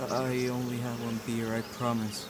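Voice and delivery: low, soft admission; sheepish confession. Acted. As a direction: own up quietly, voice dropped. Employee admits to drinking.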